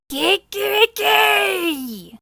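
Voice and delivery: loud, high, slow furious crow; rooster crow. Acted. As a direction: crow in rage.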